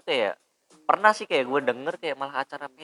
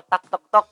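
hammering sounds; 8 semitones higher, 9 dB louder than usual. Retold or imitated. Imitating hammering nails.

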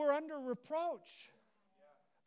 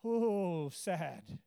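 mock sad; 7 semitones lower than usual. Shown as a demonstration. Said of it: "minor chord progression".

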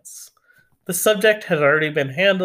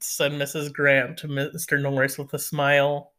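ordinary delivery, nothing marked

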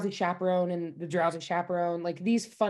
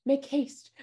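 fake English accent; 6 semitones higher than usual. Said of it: "faking an accent".

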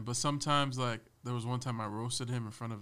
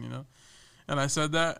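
ordinary talk

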